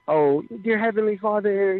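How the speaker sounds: slow, lifted churchy prayer voice; a hint of pious formal praying; mock formal prayer. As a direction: put on a proper church prayer, slowly. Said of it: "So it don't be the".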